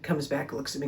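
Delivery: ordinary talk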